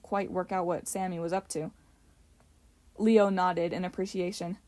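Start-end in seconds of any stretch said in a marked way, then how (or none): none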